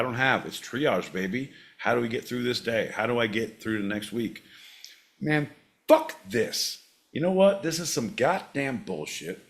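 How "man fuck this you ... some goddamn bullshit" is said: angry outburst. Retold or imitated. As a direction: blow up at how unfair it all is. Imagined angry self.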